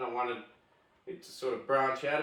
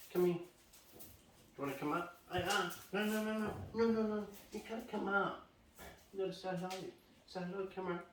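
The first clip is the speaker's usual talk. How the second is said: higher, softer pet-talk voice; coaxing the dog; 6 semitones higher, 6 dB quieter, faster than usual. Real, not acted. Owner coaxing his dog Leo toward the camera, high and soft. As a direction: sweet-talk the dog into coming over.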